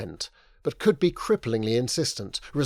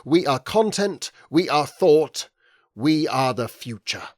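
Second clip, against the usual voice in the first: block capitals; 3 semitones higher, 4 dB louder than usual. A narrator's reading. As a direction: shout it like a slogan. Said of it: "it said in block capitals".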